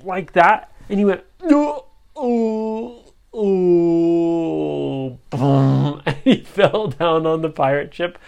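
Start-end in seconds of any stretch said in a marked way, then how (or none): 1.4-6.0 comic pained cry. Acted. Henchman blasts himself.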